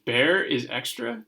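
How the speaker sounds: ordinary delivery, nothing marked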